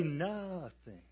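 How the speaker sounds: slow, long-stretched "nothing"; drawn-out NOTHING. Acted. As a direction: stretch "nothing" out and let it fall away. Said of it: "hear it said that way".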